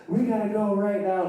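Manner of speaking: high-pitched urgent call; urgent insistence. Acted. Friend banging on the door again and again, urgent insistence.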